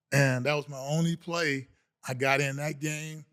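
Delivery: usual speaking voice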